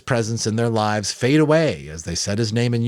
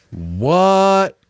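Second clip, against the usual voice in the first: surprised; 11 semitones higher, 7 dB louder than usual. Real, not acted. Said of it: "reader's own reaction".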